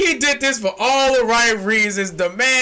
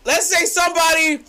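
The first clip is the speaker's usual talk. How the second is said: usual speaking voice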